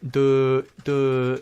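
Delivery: dim, halting dopey voice; Forrest Gump. Acted. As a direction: draw out a slow-witted dumb sound. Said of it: "Forrest is like".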